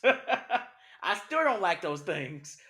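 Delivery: laughing through it, loud and slowed; a real laugh breaking into the words. Real, not acted. Admitting he still hates getting dirty, laughing. Laughing admission about disliking dirt.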